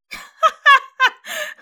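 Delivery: high, loud burst of laughter; real high-pitched cackling laugh. Real, not acted. Laughs after her moral disclaimer.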